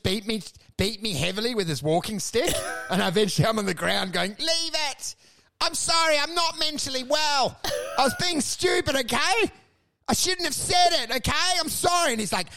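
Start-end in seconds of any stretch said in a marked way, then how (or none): 4.4-12.1 high, whiny begging with a tearful edge; hammed-up pleading. Beaten man on the ground begs the old guy, hammed-up pleading. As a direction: grovel for mercy, overdone.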